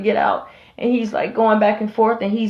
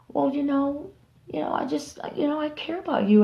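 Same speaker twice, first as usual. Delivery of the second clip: higher, much softer sweet-talk; soft higher fake tenderness; fake caring voice; 6 semitones higher, 7 dB quieter than usual. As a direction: coax like a false friend. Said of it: "that calming voice".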